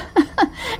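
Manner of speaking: high shrill giggle; high laugh. A narrator's reading. Dora laughs.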